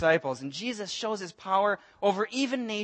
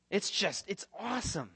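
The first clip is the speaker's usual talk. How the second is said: ordinary delivery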